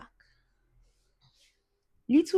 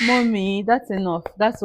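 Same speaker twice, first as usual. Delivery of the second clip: calling out; 5 semitones higher, 7 dB louder than usual. Real, not acted. Calling out to her mother.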